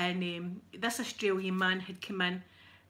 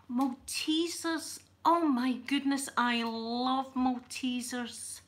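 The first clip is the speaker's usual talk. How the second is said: gushing, drawn-out higher exclamation; delighted; 4 semitones higher, much slower than usual. Real, not acted. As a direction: savour each word slowly with real delight. Delighted at finding chocolates.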